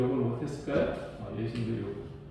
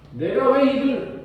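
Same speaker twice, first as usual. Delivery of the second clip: higher, slow indignant outcry; Paul yelling back; 8 semitones higher, 4 dB louder, slower than usual. Retold or imitated. Paul shouting back at the high priest, indignant. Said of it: "더 크게 소리를 질러요".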